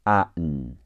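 ordinary talk

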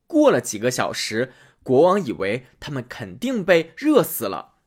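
usual speaking voice

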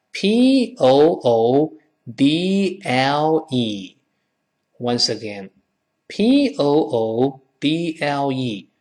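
letter by letter, drawn out; slow spelling. Shown as a demonstration. Teacher spells poodle for the class, slowly, letters stretched.

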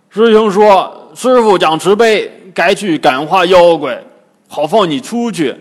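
loud, heavy-voiced Bajie character; Bajie's relayed taunt. A narrator's reading. (八戒大声学舌)

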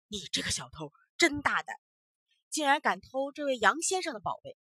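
the magistrate turns on the foreigner, rebuking loudly; accusing magistrate. Acted. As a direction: loudly accuse him of theft.

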